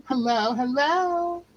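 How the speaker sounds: lightly drawled Southern hello, slow; a hint of a Southern drawl on hello; Southern greeting. Greeting a viewer in the chat, light Southern accent. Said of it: "Miss Accent".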